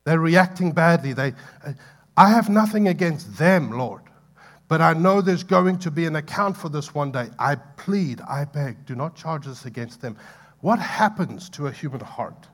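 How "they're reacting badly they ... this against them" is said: raised, pleading voice crying out to God; loud pleading cry. Retold or imitated. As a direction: cry it out to heaven, begging. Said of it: "he cried out into eternity".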